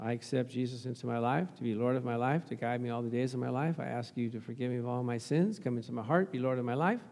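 low, quiet recited prayer; sinner's prayer. Shown as a demonstration. Preacher showing congregation the sinner's prayer, low quiet recitation. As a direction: recite it softly in a low, even run. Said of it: "with the sinner's prayer".

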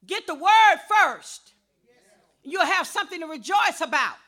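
loud, insistent pulpit exhortation; full-force preaching. Real, not acted. Preacher scolding people too busy for church, forceful preaching. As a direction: admonish the congregation with punched key words.

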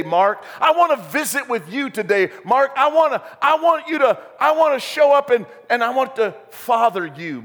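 Jesus inviting himself over, urgent and loving; Jesus calling Mark. Retold or imitated. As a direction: call to Mark like an eager father. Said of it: "Jesus says".

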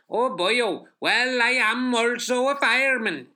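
high, loud Welsh fireman; big Welsh accent, hammed up. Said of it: "That was a Welsh. Let me do it".

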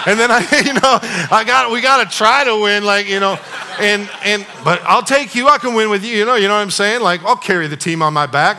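loud, high cocky boast; a kid sure he can win alone; cocky kid bragging. Retold or imitated. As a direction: brag like a cocky young punk.

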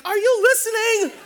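high, loud, laughing divine voice; voice of the Holy Spirit. Retold or imitated. Preacher voices the Holy Spirit nudging him mid-conference, high and loud. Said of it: "the Holy Spirit spoke to me like".

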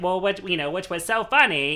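lightly imitating David Lynch, high and loud; a touch of Lynch's nasal Midwestern twang. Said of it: "that nasal Midwestern voice".

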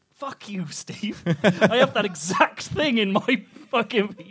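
high, loud indignant protest; comic outrage. Real, not acted. Comedian to host who used his joke, indignant. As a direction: protest with growing exasperation.

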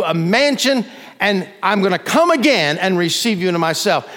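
loud, high-pitched, driving sermon delivery; full-force preaching. Real, not acted. Preacher promising the congregation heaven, loud and impassioned.

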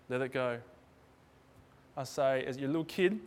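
normal talking voice

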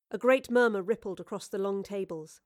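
ordinary delivery, nothing marked

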